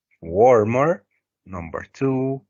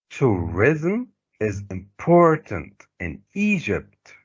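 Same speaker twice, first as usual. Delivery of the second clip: IMPORTANT with its middle syllable leaned on; measured model reading, stressing TOURISM, IMPORTANT and EGYPT; marked word stress. Shown as a demonstration. Teacher reading for students to repeat, marked word stress. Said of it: "Use the correct word stress".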